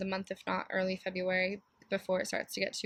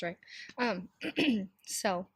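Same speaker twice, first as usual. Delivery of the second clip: normal talking voice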